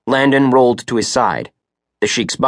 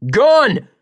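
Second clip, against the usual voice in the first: sudden high-pitched yell; one long, raised warning shout; 3 dB louder than usual. A narrator's reading. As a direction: cry out an urgent alarm. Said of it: "and yelled".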